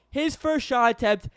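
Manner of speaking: talking through a yawn; words stretched by a yawn. Real, not acted. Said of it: "I apologize for the yawning".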